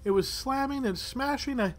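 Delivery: high-pitched, unsettled villager voice; bewildered older woman. Acted. Older woman tells adventurers about the attack, bewildered. Game master plays the old woman.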